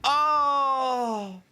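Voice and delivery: a high, loud burst of excitement; excited shout. Real, not acted. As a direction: yelp with real delight at the loot. Sudden good loot drop.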